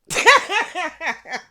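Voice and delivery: loud, high cackling laugh; big high-pitched laughing fit; burst of laughter. Real, not acted. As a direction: crack up at yourself. Laughing at own pronunciation.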